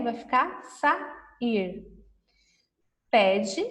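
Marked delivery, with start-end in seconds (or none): 0.0-1.9 slow and clear. Shown as a demonstration. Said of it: "Se eu colocar o R".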